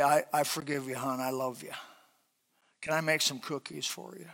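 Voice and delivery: soft, tender forgiving words; gentle forgiving wife. Pretend. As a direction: say it softly, lovingly.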